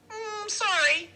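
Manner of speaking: very high, loud, drawn-out apology; chattery high voice. A narrator's reading. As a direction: squeak the apology out, stretched and high. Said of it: "his voice is chattery high".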